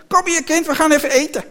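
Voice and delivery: high, loud voice of the woman; calling to table. A narrator's reading. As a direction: call the child sharply to dinner. Vrouw roept kind aan tafel. (hoog en luid aan tafel roepen)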